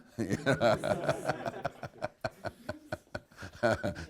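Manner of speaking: long, high delighted laughter; rolling amused laughter. Real, not acted. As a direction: laugh hard at the joke. Laughs at wife's punchline.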